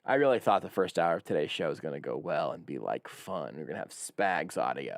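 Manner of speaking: low, soft, deflated grumble; dejected. Real, not acted. Host lamenting how today's show went, dejected. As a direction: sink down low and quiet in disappointment.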